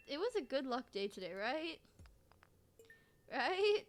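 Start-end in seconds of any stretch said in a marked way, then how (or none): 3.3-3.8 1 eager question. Real, not acted.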